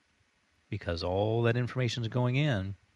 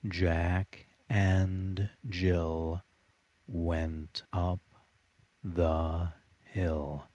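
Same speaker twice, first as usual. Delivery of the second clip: very slow, low robotic monotone; monotone hypnosis voice; 3 semitones lower, much slower than usual. Shown as a demonstration. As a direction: stretch each word low and even. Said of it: "Practice saying it just like this".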